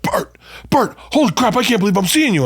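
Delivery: put-on high, squealing starstruck kid; excited teen fan. Teen fan meeting the comic outside his suite, high excited gush. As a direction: gush like a teenage fan spotting his idol. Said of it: "he's like".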